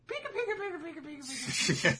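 high, chattering mock villain laugh; imitated cartoon cackle on "pika"; Pika's silly laugh. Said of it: "I do like that he laughs".

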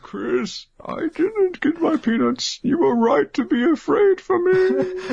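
very high, whining voice; lamenting old man. Acted. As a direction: plead like a wronged old man. Jocko lamenting to Chris.

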